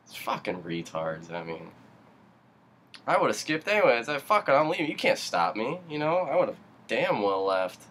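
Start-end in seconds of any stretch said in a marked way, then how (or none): 3.1-7.8 defiant outburst. Real, not acted. Defiant rant about skipping work.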